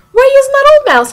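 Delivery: high, loud, quick questioning; mock incredulous demand. Teacher Linda challenging a student over the old mouse, mock incredulous.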